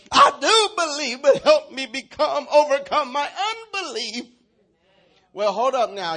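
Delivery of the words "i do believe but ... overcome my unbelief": loud, high, drawn-out plea; crying out. Acted. Father cries out to Jesus for help, crying out. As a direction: cry it out at full force as a desperate father. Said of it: "The father instantly cried out".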